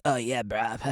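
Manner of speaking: low, soft mock dude voice; dumb surfer. Surfer sounding dumb to outsiders, low mock dude. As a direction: drop low for a dopey bruh. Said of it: "it sounds pretty dumb".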